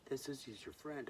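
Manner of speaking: put-on high, incredulous kid voice; amazed kids. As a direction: squeak it like a stunned child. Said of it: "they'd be like".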